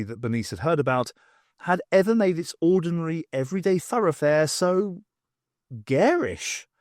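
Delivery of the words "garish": ordinary delivery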